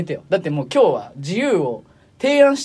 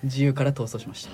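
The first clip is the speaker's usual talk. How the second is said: hoarse voice; 4 semitones lower, 6 dB quieter than usual. Real, not acted. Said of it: "ちょっと喉枯れてるからね".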